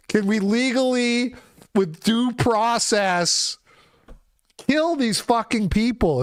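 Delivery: high-pitched, emphatic begging; insistent, exasperated plea; exasperated pleading. Real, not acted.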